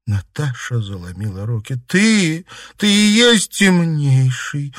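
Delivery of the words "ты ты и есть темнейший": high, loud, drawn-out accusation; stunned, raised voice; breaking realisation. A narrator's reading. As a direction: let the shocked realisation burst out slowly. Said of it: "Наконец выдохнула она".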